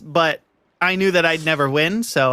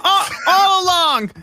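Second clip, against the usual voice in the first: surprised shout; 13 semitones higher, slower than usual. Real, not acted. Shocked at London reveal.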